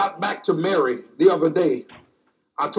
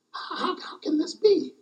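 high breathy whisper; whispered wonder; 10 semitones higher, 7 dB quieter than usual. Acted. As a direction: breathe it as an awed question. Mary's doubtful question acted.

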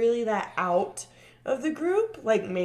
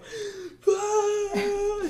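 mock gasp; 12 semitones higher than usual. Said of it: "She's, like, screaming, crying almost".

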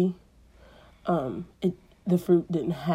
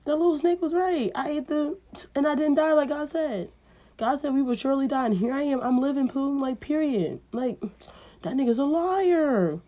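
much higher, amazed voice; thrilled to be alive; high excited realization; excited Eve; 7 semitones higher than usual. Acted. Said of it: "she was like".

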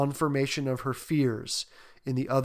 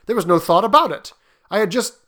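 fast, loud and higher; agitated insistence; 6 semitones higher, 9 dB louder, faster than usual. A narrator's reading. Husband insisting to his wife, quick and agitated. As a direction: rush to convince her before she stops you.

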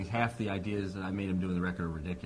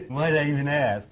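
louder, higher exasperated retort; mock exasperation; 5 semitones higher, 10 dB louder than usual. [name] answering Steinman about the Harley, mock exasperated. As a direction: throw it off with mock weariness. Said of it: "I thought so".

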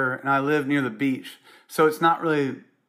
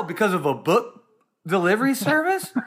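incredulous; 6 semitones higher than usual. Real, not acted. Incredulous at Amazon millionaires.

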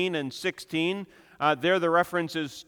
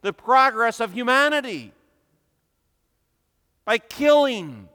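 loud, high, impassioned pulpit delivery; fiery preaching; 7 semitones higher, 8 dB louder than usual. Acted. Preacher condemning the age to his congregation, loud and impassioned. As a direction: thunder it from the pulpit against the evil.

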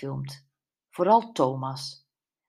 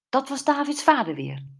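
high, excited exclamation; called out; 9 semitones higher, 4 dB louder than usual. A narrator's reading. Andy recognizes the father's voice, calling out excitedly. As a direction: blurt it out with raised pitch. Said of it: "riep Andy".

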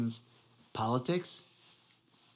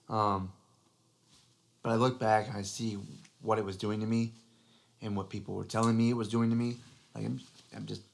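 ordinary talk, nothing marked

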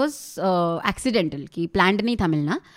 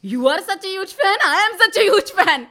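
high, stretched-out gushing disbelief, half laughing; disbelieving squeal; 8 semitones higher, 5 dB louder, much slower than usual. Retold or imitated. To the filmmaker who just praised her, a high laughing squeal of disbelief. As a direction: squeal it back in stunned delight. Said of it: "बोली".

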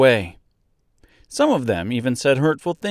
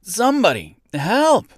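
high, louder cry for help; shrieked plea, pitched way up; screaming out; 10 semitones higher, 4 dB louder than usual. A narrator's reading. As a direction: call desperately to a far-off crowd. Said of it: "he screamed out".

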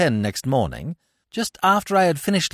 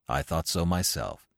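father's voice; 10 semitones lower, 7 dB quieter than usual. Acted. Father agreeing with his son.

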